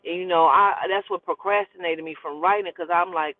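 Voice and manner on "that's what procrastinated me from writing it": ordinary delivery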